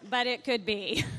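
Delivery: loud, laughing aside; real amusement bursting into the line. Real, not acted. Laughing at own joke about pastor.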